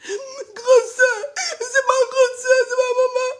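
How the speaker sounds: high, loud wailing through the words; hammed-up sobbing imitation of the woman in the video; mock crying woman. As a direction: mimic her crying, half laughing. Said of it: "Viens te montrer comment elle parlait".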